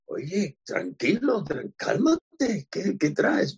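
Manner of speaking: mock calming. Narrator telling pushy man to calm.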